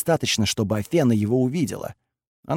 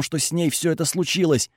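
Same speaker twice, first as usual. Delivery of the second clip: ordinary delivery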